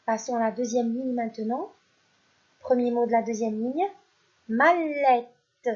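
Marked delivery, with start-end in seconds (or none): 4.5-5.7 "mallette" projected clearly; teacher calling out "mallette"; loud dictation. Shown as a demonstration. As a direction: dictate "mallette" with a falling tune. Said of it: "Je répète".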